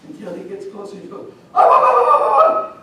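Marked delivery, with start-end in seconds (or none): 1.5-2.8 very high, loud repeated cry; mother's warning sound. Acted. Mother scaring off the cat, loud howling sound. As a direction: let out a piercing repeated howl. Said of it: "she's doing a sound".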